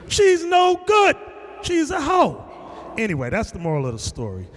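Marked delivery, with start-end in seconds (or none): 0.1-2.5 slow, high, loud shout; drawn-out shouted condemnation; shouted verdict. Retold or imitated. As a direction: yell the verdict at him. Retells shouting at brother.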